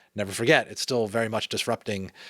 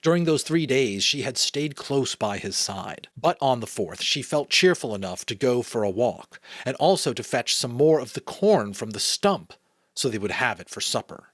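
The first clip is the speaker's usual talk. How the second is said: measured read-aloud storytelling; story narration; 4 semitones higher, 5 dB louder than usual. A narrator's reading. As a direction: read a children's novel aloud.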